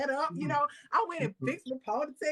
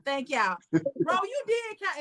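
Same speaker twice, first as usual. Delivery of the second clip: ordinary talk, nothing marked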